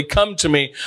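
ordinary talk

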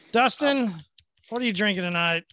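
ordinary talk

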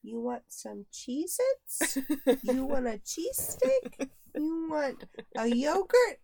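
very slow, singled-out offers in imitation; drawn-out pretend-mommy questions; little girl playing mom. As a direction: imitate a child mothering a baby. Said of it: "she could be the mom".

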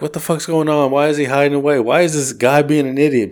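a touch of passers-by calling him an idiot; bystanders jeering. Retold or imitated. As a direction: heckle him lightly. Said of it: "It's like".

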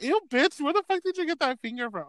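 high, disgusted mock voice. Imagining finding a finger, high mock disgust. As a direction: squeal the question, half grossed out. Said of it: "I was like".

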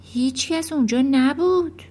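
ordinary delivery, nothing marked

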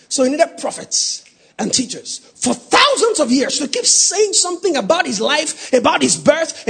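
loud, high preacher's rising cry; preaching at full force. Acted. Preacher urges the congregation about the prophets, shouting. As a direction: hammer the point home.

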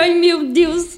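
high, loud mock alarm; hammed-up gasping "oh my God"; imitating a dramatic reaction. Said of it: "ele vai olhar".

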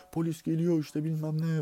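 high-pitched imitated warning; urgent alarm call. Runner warning sleepers that police are coming, retold alarm call.

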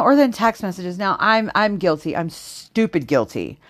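usual speaking voice

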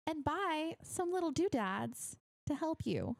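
high, bright sponsor pitch; ad read. Acted. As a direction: sell it like a radio ad. Reads a sponsor ad.

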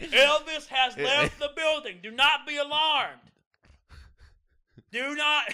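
high, loud, slow announcer-like coach; coach catchphrase impression. Imitating the coach announcing a student leaving. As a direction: boom it out like a corny coach. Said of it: "This is literally something he would say".

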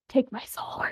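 hushed, whispered joke offering; mock-dramatic whisper. Co-writer jokes about Ash offering to be possessed, mock whisper.

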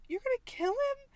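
shocked question. Retold or imitated. Jessica hearing they plan to kill Bill, shocked. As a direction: squeak it out in disbelief.

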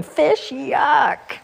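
high, disgusted yuck; little owl grossed out. A narrator's reading. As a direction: recoil from the fish.

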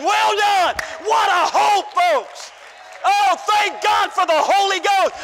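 loud, high shouted preaching; preaching at full force. Real, not acted. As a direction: shout the hope to the congregation.

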